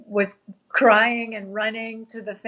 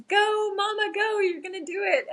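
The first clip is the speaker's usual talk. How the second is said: lightly voiced kids cheering, much higher; cheering daughters; 12 semitones higher than usual. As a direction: imitate her little girls egging mom on. Daughters cheering her over FaceTime.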